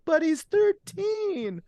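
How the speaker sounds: very high, stretched-out protest; disbelieving mother. Retold or imitated. Mother to fake cop about her son, disbelieving. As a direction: imitate a mom, slow and way up high. Retold mother hearing of son's arrest.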